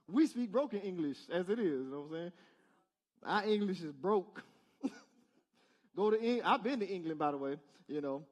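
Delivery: normal talking voice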